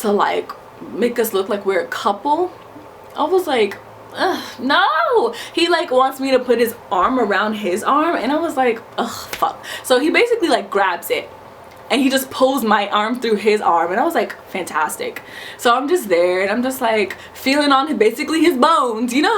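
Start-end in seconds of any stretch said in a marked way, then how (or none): 4.1-5.3 high, grossed-out "ugh, no"; disgusted groan. Retold or imitated. Refusing to look like his girlfriend, repulsed. 9.0-9.6 breathy muttered "ugh, fuck" under the breath; whispered curse. Retold or imitated. As he grabs her arm, a resigned whisper. 14.5-15.2 lower, softer, dry "Fantastic"; flat sarcastic resignation; deadpan sarcasm. Retold or imitated. Arm pulled through his, dry sarcastic mutter.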